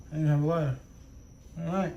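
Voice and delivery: usual speaking voice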